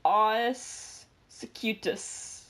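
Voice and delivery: "Aws secutus" drawn out slowly like a Latin term; mock Latin. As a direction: pronounce "Aws secutus" as a solemn fake Latin name. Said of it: "Or in Latin".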